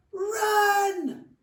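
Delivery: high, loud, drawn-out scream of alarm; cried out. Acted. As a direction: yell it to save your friends. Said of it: "he cried".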